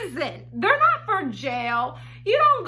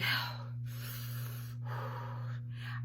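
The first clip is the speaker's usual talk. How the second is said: a deep, breathy sigh pushed out before the question; long exasperated sigh; 16 dB quieter than usual. Retold or imitated. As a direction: sigh like you're bracing yourself. Dramatic pause before asking.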